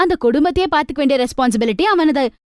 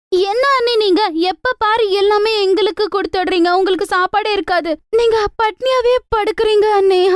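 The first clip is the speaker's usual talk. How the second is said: put-on young sister-in-law; 5 semitones higher than usual. Sister-in-law scolding lovingly.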